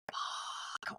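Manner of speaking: breathy whispered swear under the breath; quick voiceless swear at the long toilet queue; whispered curse. Retold or imitated. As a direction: hiss the curse almost silently, no voice. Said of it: "I'm like".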